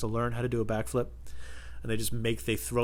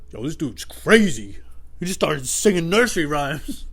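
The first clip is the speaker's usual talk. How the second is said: slow, louder disbelieving voice; imitating a weirded-out bully; mock astonished bully; 5 semitones higher, 5 dB louder, slower than usual. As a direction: stretch it out in stunned disbelief. Voices the bullies' reaction.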